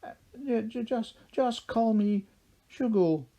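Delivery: high, stuttering, scared mumble; nervous stammer. A narrator's reading. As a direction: stammer it out, terrified. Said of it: "he muttered".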